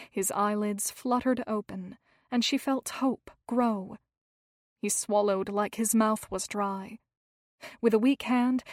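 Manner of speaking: plain reading voice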